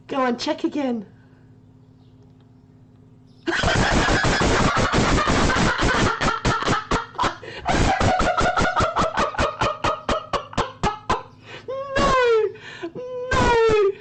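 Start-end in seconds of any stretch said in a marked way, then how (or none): 3.5-11.2 wheezing soundless laughter; high breathless laugh. Real, not acted. Streamer collapsing at her one percent IQ, high wheezing laugh. 12.0-13.9 high, loud tearful no's; laughing protest. Real, not acted. Denying her one percent score, high laughing protest. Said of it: "I'm crying".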